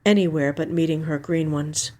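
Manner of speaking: plain reading voice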